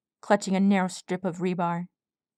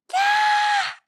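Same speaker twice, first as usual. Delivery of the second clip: very high loud pained shriek; shrieking scream; 5 dB louder than usual. A narrator's reading. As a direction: shriek in pain. Scream of pain.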